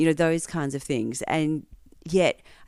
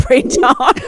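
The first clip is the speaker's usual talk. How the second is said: much higher, much louder laugh on print on; bursting laugh; 12 semitones higher, 12 dB louder than usual. Real, not acted. Telling how all the women over fifty wore animal print, laughing loudly. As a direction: break into a big laugh.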